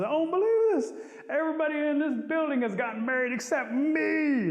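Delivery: high, loud hammed-up wail; mock crying. A narrator's reading. Possum left out of the weddings, wailing. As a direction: build a play-acted bawl of disbelief. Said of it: "And he begins to cry".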